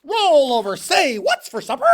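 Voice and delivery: high loud goofy chant; silly loud cheer. A narrator's reading. Tacky shouting the wrong moves, high and loud. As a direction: yell it like a clown.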